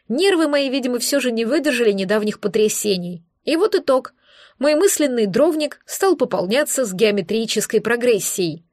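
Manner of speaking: ordinary delivery, nothing marked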